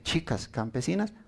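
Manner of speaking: "chicas campesinas" said softly with a mild sneer; scornful tone. Shown as a demonstration. Preacher showing how a sneer turns truth into insult, quietly scornful. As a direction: let a little contempt into "chicas campesinas". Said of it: "Pero si se les decía".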